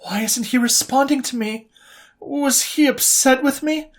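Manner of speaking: high, anxious, spiralling self-doubt; anxious young woman doubting herself; anxious inner voice. A narrator's reading. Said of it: "her anxiety had hit an all-time high".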